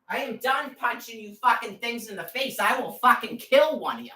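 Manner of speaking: high-pitched real fury; angry threat. Real, not acted. Woman fed up with the people harassing her, furious threat.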